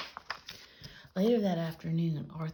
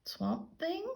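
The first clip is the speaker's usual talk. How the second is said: much higher worried rise; startled question; 12 semitones higher than usual. A narrator's reading. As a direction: blurt it out scared. Tommy frightened question.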